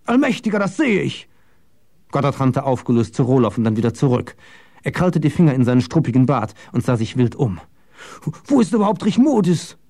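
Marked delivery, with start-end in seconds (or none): 0.1-1.3 high, agitated outburst; shocked, high-pitched dismay; distraught exclamation. A narrator's reading. Distraught at news of a death. 8.5-9.8 high, agitated question; high-pitched anxious fretting; frantic worry. A narrator's reading. Frantic old man seeks missing woman.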